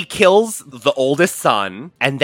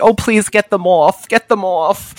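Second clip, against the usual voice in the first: mock prudish plea; 6 semitones higher than usual. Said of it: "I was just like".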